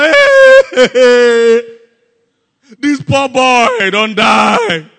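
slow, loud, drawn-out wail; hammed-up mourner's howl; loud mock crying. Professional crier wailing over the dead, exaggerated loud crying. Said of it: "So this was loud crying".

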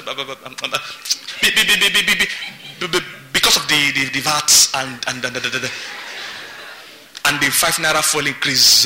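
babbling, stammering nervous applicant, pitch jumping about; a hammed-up tongue-tied applicant stammering his excuses; mock stammering. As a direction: stumble over every word like a flustered job seeker. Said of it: "And he's stammering".

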